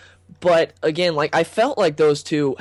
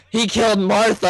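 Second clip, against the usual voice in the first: "Martha" said higher, mocking; mocking wrong line; 6 semitones higher than usual. Shown as a demonstration. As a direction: poke fun at saying "Martha". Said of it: "He wasn't like".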